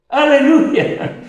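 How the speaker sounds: high, slow, laughing shout of praise; joyful hallelujah. Real, not acted. Preacher praising God, high slow laughing cry. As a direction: let the aleluya ring out. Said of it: "exultant hallelujah".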